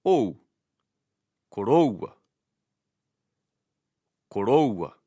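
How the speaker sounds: "koroua" stressed loudly on the OU; stress on diphthong. Shown as a demonstration. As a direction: say "koroua" as ko-ROU-a. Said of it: "The stress is on the diphthong".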